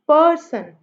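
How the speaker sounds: falling "person", weight on पर; stress on first syllable. Shown as a demonstration. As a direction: punch पर in "person", let सन drop. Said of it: "सन को हम लोगों ने बहुत soft बोला".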